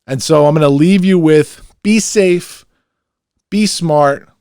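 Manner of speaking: rally the listeners as the show ends; loud sign-off. Real, not acted. Host closing the episode to fans, loud hyped sign-off. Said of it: "I'm gonna get loud like I always do".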